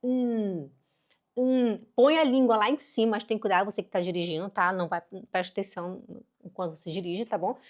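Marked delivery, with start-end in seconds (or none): 0.0-1.8 "hum" as a held n, tongue flattened up; isolated, held n sound; N sound demo. Shown as a demonstration. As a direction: sustain a humming "nnn" for "hum". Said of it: "Aí fica".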